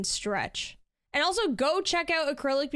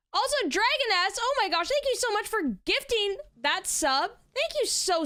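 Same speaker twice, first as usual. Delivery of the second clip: high-pitched gushing thanks, much higher; excited high squeal; 9 semitones higher than usual. Real, not acted. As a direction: squeal with delight. Thanking a gifted sub again.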